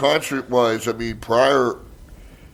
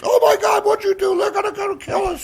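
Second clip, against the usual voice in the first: very high mock panic; scared relatives. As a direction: squeal in hammed-up terror. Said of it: "They're just".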